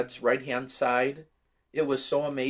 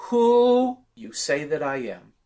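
louder, slow, weighty question; Jesus questioning disciples; 3 semitones higher, 6 dB louder than usual. Retold or imitated. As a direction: ask it slowly like a solemn teacher. Retelling Jesus asking disciples.

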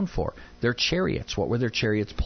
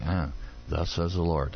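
weary Pharaoh; 5 semitones lower than usual. Retold or imitated. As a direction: groan it like you've heard it a hundred times. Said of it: "You gotta think Pharaoh's going".

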